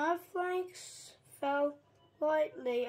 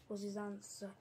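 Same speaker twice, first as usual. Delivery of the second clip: ordinary delivery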